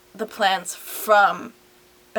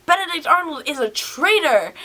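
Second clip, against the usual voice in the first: excited shock; 8 semitones higher than usual. Acted. Soldiers discover the traitor's name.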